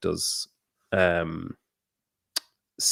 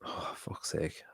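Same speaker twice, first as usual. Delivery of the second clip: breathy whispered curse under the breath; exasperated whisper; 3 semitones lower, 20 dB quieter, much slower than usual. Real, not acted. Friend whispers in exasperation about accents, exasperated whisper. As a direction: sigh the curse out in a whisper, slowly.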